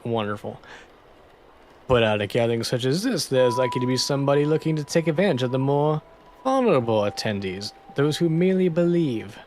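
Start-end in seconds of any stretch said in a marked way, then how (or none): 1.9-9.4 lightly put-on French accent; a hint of a French gentleman warning. Toussaint warns about fraudsters, light French accent. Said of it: "My French accent is absolutely".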